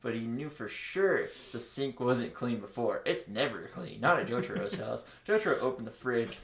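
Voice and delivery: ordinary delivery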